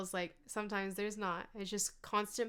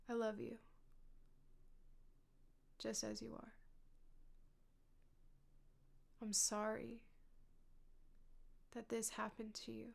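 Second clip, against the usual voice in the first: tender self-comfort; 11 dB quieter than usual. Shown as a demonstration. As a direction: speak gently to your hurting self. Soothing self-talk after a sigh.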